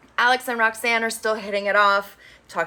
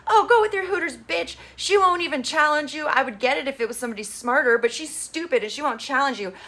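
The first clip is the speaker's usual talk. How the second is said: mock jealous rant; 6 semitones higher than usual. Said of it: "And she's like".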